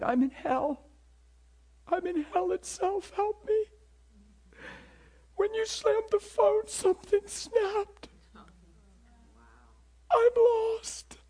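high tearful wail fading to a whispered sob; crying uncontrollably. Retold or imitated. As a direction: imitate a desperate caller weeping and begging for help. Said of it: "the person crying uncontrollably".

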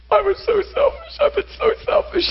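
very high mock crying; high-pitched tearful imitation; retold tearful confession. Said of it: "gasping for breath and crying".

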